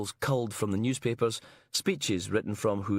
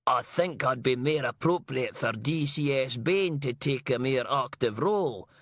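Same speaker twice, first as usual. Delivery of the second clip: mocking a boss in broad Banff and Buchan Scots, pitched up; broad Banff and Buchan accent; 3 semitones higher than usual. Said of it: "She put on a broad Banff and Buchan accent for".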